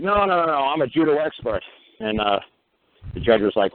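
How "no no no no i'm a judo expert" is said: high, whiny protest as the Crown; hammed-up high-pitched insistence; mocking prosecutor. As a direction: play the prosecutor as a squeaky know-it-all. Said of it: "the Crown was like".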